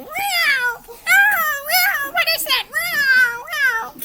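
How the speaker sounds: high, loud frantic meows; screaming cats. Acted. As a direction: yowl while running away. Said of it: "ran off screaming".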